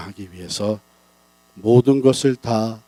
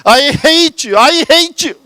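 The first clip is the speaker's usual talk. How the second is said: put-on high, loud angry child's shout; a hurt six-year-old screaming from the hill; 9 dB louder, much slower than usual. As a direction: yell it off the hilltop. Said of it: "소리를 지릅니다".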